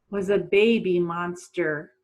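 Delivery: ordinary talk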